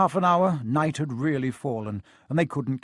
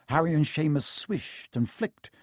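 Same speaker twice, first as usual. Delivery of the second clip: usual speaking voice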